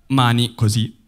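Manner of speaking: normal talking voice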